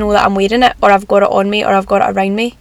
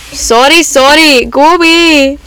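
shooing away; 4 semitones higher, 4 dB louder, slower than usual. Real, not acted. Shooing someone off mid-recording.

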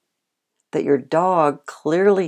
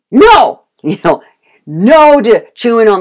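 high, very loud shout of no; scolding no; 8 semitones higher, 12 dB louder than usual. Shown as a demonstration. Owner shouting no at a misbehaving dog. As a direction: bellow it at the dog. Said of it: "scolding demo".

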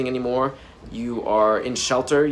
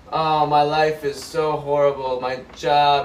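mock complaining; slower than usual. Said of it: "and you want to complain".

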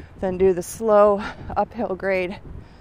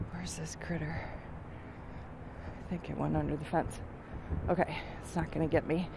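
whispered, much softer and lower, then quickly murmured; hushed; 6 semitones lower, 14 dB quieter than usual. Real, not acted. Walker spotting a groundhog by the fence, hushed whisper. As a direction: hush so you don't spook the animal.

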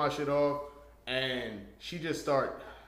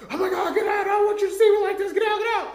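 much higher, loud, fast frantic cry; panicked shout; 10 dB louder, faster than usual. Retold or imitated. Woman embarrassed after throwing up yells at him to leave, panicked shout.

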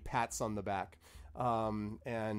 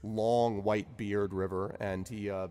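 usual speaking voice

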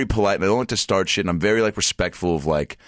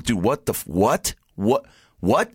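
higher-pitched, baffled what; can't believe it, retelling the shock; retold disbelief; 5 semitones higher, slower than usual. Customer aghast at mirror after haircut, retold disbelief. Reacting to his bad haircut.

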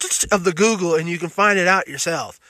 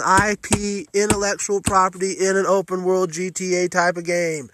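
ordinary talk

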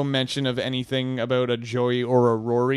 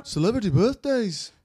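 a light attempt at a Liverpool accent, pitched higher; 8 semitones higher than usual. Asked by cohost to say it like the Beatles, Liverpool accent. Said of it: "but with the Beatles sound again".

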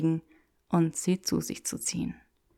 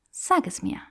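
demanding. Acted. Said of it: "verlangte Granger".

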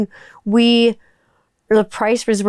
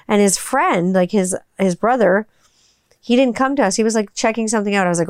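usual speaking voice

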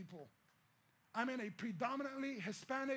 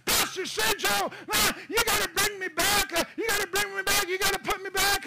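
shouted, pitched much higher, pleading to be sent back; desperate pleading shout; 10 semitones higher, 14 dB louder than usual. Retold or imitated. Pleading with Pastor Sergio to return, desperate shout. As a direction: yell it like you're begging for your life. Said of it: "and I'm crying".

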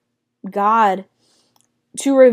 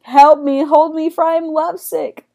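high, pleading mock swoon; lovesick woman freaking out; 6 semitones higher, 3 dB louder than usual. Lovesick woman begs for help, hammed-up high pleading. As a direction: plead dramatically, overwhelmed by love. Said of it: "like, she's like that picture".

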